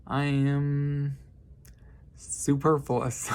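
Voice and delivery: slow, lofty pronouncement; grandiose answer. Retold or imitated. Greeting the secretary, slow mock-grand showing off. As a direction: savour the fancy word slowly.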